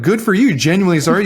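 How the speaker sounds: sarcastic. Retold or imitated. Betrayed partner scoffing at the apology with loud, high sarcasm. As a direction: toss it back with sarcasm.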